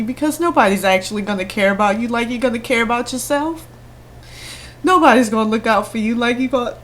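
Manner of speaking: high, teary voice; fighting back tears; tearful. Real, not acted. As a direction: still crying underneath. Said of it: "I don't mean to cry".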